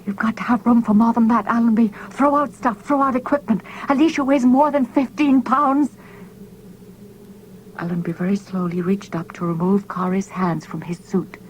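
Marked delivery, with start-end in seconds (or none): 0.1-6.0 higher, urgent pleading; desperate plea to the captain. A narrator's reading. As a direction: beg him to make room. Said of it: "his eyes pleading".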